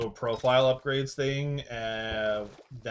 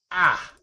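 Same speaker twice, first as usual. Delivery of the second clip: short, loud, high "ah!"; startled yell; 7 semitones higher, 10 dB louder than usual. Retold or imitated. Streamer reenacting the shock of a sudden explosion, startled yell. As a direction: mimic your own jolt of fright. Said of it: "every time you do that, I'm like".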